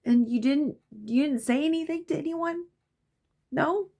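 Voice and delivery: very high, climbing pitch; acted-out stunned mother pressing for answers; incredulous mom questioning. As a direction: squeak the questions in disbelief. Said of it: "And I'm like".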